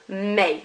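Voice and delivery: Mes said as may, no S; silent S. Shown as a demonstration. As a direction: say Mes without the final S. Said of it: "I'm not pronouncing it".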